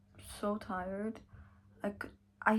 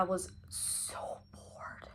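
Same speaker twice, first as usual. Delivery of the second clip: whispered boredom; 10 dB quieter, much slower than usual. Real, not acted. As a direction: whisper it like a guilty confession. Confessing boredom to viewers.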